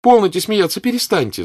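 high, loud cry to stop; laughing protest. Acted. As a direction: daughter shouting at her laughing mother. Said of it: "закричала Наташа".

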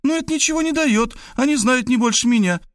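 put-on high girlish voice, earnestly bragging; boastful young woman. A narrator's reading. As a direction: brag and flatter, pitched high. (высокий девичий голос, хвастливо и убеждённо)

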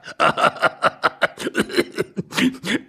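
wheezing, breathy run of laughter; long helpless laugh. Acted. Husband laughing at the news, long and wheezy. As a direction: laugh until the breath runs out.

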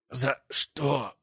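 high strained croak. A narrator's reading. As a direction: squeeze out a dying question. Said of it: "снова раздявил рот Неждан".

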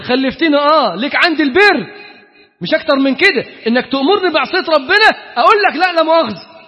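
mock harsh retort. A self-righteous son lecturing his father, loud and harsh. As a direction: lecture your own father sharply.

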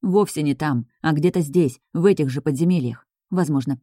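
usual speaking voice

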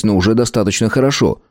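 usual speaking voice

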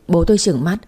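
usual speaking voice

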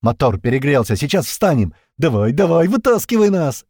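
loud, urgent shout. A narrator's reading. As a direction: yell urgently over engine. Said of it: "Пьер прокричал".

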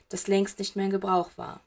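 plain reading voice

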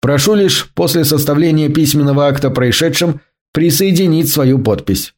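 plain reading voice

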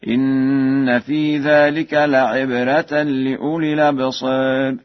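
slow chant, "الأبصار" tilted toward absèr; taqlil. Shown as a demonstration. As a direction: stretch the nasal n, half-tilt the a of "الأبصار". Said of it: "التقليل في ذوات الياء والراء". (ترتيل مع تقليل ألف "الأبصار")

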